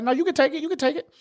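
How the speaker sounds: high-pitched, repeated brush-off; insistent refusal. Retold or imitated. Tourist refusing a pushy vendor's gift, high insistent protest.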